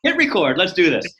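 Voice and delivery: high, loud burst of enthusiasm; excited cheer. Retold or imitated. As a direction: belt it out like a rallying cry. Upbeat retelling of starting recording.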